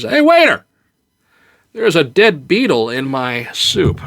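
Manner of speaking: flag down the waiter; mock complaining diner calling out.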